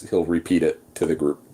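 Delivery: usual speaking voice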